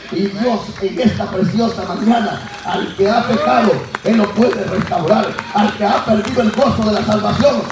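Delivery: loud, rousing preacher's proclamation; prédica a plena voz. Real, not acted. Preaches at full force to congregation. (predicando con gran fuerza)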